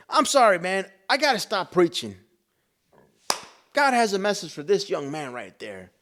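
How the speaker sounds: high, loud retold preacher announcement; preacher calling out. Preacher singles out a young man, calling out.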